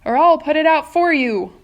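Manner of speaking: high, loud warning; threatening shout. Acted. Centipede threatening the glowworm, loud high shout. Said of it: "shouted the centipede".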